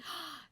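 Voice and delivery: high, soft gasp; quick audible intake of breath. Acted. Cohost reacting to a spying suggestion, gasp.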